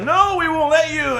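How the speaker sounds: high hammed-up villain defiance; mock villain retort. Fire soldiers defying the villagers, mock villain retort. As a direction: snap back like a cartoon baddie. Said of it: "the Fire dudes are like".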